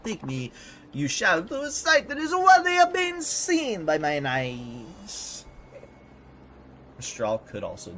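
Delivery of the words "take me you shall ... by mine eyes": high, loud French diva; questionable French accent. Acted. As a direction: proclaim your stardom in a hammy French accent. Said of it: "do my questionable French accent".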